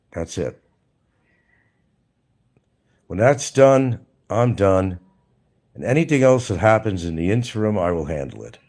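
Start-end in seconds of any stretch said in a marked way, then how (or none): none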